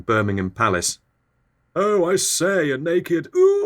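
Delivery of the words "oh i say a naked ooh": high, put-on exclaiming voice; startled onlooker. Palace onlooker spotting a naked flier, high startled voice. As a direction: exclaim far above your usual pitch, ending in an ooh.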